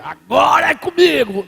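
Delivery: high, loud mock battle cry; overconfident boast. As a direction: shout it like a cocky volunteer, hammed up. Acts overconfident believer's boast.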